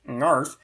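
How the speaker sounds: "ngarf" starting on a velar nasal; initial velar nasal. Shown as a demonstration. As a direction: begin "ngarf" with the ng of "song". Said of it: "starts with a velar nasal".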